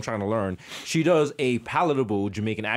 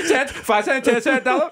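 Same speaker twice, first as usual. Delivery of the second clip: high, excited hawker's patter; hammed-up high vendor voice; mock street seller; 11 semitones higher than usual. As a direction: call out prices high and quick. Acting a haggling Jamaican seller.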